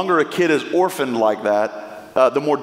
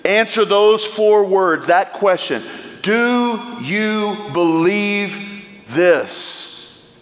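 preaching at full force; 5 semitones higher, slower than usual. Real, not acted. Preacher appealing to the congregation over music, fervent. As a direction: drive the question home.

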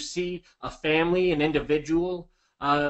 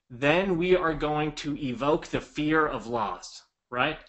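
ordinary delivery

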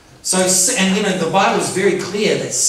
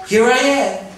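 higher, ready reply; prompt answer to a call; 6 semitones higher, 4 dB louder than usual. Retold or imitated. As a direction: answer the summons straight away. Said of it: "Straight away".

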